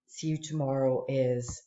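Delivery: ordinary talk, nothing marked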